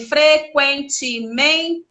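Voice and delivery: frequentemente slowly split into five syllables. Shown as a demonstration. Teacher sounding out a pupil's error, frequentemente syllable by syllable. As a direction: break frequentemente into fre-quen-te-men-te. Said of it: "Uma palavra com cinco sílabas".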